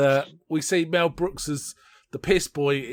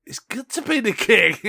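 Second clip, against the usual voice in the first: higher, louder smug wink to the camera; hammed-up king aside; 7 semitones higher, 4 dB louder than usual. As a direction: toss it to the camera, pleased with yourself. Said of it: "looks at the camera and goes".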